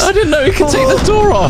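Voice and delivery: scared horror game streamer. Real, not acted. Bigfoot takes door.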